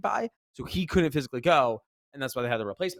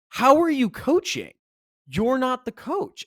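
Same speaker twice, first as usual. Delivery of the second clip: incredulous confronting; 10 semitones higher than usual. Retold or imitated. Confronting coach in retold scene.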